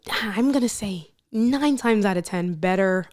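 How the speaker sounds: ordinary talk, nothing marked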